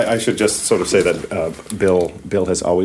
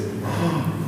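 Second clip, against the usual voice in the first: soft awed gasp; 6 semitones higher, 9 dB quieter than usual. Real, not acted. Gasp on seeing the stairwell.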